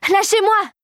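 high, frantic cry; shrieked demand. A narrator's reading. Clara is swarmed by mice, frantic high shriek. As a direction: cry out high to fend them off. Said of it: "s'égosilla-t-elle".

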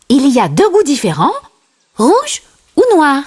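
quick, high character voice; Timon. A narrator's reading. Timon pitches ant flavours to Simba, high and quick. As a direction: rattle off the choices in a high sidekick voice. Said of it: "dit Timon".

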